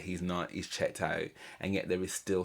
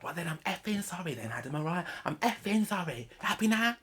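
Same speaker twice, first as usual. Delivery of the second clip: mocking explosion; 11 semitones higher than usual. Vlogger mocking Polly's outburst at Adam, high sarcastic shriek. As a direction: mimic her sarcastic blow-up, pitch way up. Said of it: "then she explodes and she's like".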